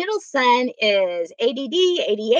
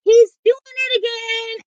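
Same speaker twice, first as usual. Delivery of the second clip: put-on tattling child; 12 semitones higher, 4 dB louder than usual. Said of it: "he would say".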